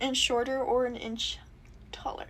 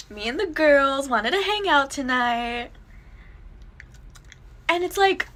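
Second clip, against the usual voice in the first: put-on Valley Girl accent. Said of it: "I love your Valley Girl accent".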